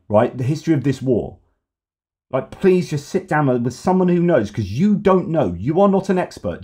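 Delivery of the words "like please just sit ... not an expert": exasperated rant. Real, not acted. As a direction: pitch climbing high in exasperation, pleading then scolding. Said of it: "rant over".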